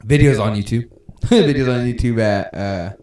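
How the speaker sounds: ordinary talk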